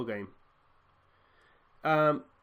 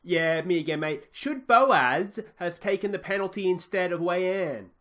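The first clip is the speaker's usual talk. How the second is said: higher, louder Australian reporter's question; Australian interviewer prodding the manager, raised pitch; Australian journalist; 6 semitones higher, 6 dB louder, slower than usual. Pretend. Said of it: "Chaz Hogan, Australian journalist".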